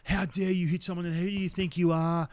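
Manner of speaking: imitating an outraged telling-off; berating. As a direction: dress someone down hard. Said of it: "he's just berating me".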